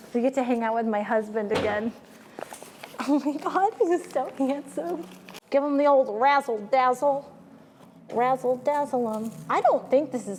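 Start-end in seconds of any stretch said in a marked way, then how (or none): none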